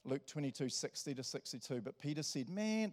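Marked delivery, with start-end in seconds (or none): 2.5-2.9 higher-pitched, drawn-out protest; indignant denial. Acted. Peter denying he knows Jesus, indignant. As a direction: act out brushing off the accusation.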